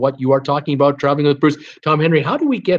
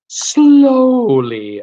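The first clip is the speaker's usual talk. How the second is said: slow, louder mock drag on the words; drawn out slowly; 9 semitones higher, 6 dB louder than usual. Host joking that the cruise line pays slowly, drawn out. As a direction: stretch it out to act the slow payment.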